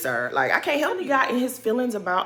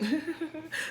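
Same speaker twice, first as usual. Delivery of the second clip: mocking laugh; 8 semitones higher, 12 dB quieter than usual. Real, not acted. Laugh before Monique quote.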